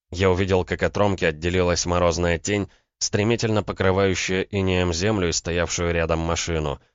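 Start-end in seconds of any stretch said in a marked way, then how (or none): none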